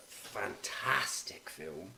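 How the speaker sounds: slow whispered aside; whispering. Real, not acted. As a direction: whisper it slowly.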